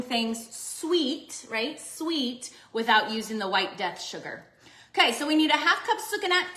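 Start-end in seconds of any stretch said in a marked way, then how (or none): none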